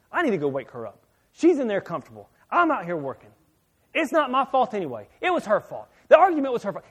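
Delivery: retold griping, climbing in pitch to loud blame; mad inner rant. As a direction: act out a self-righteous grumble rising to a peak. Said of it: "I'm, I'm so mad".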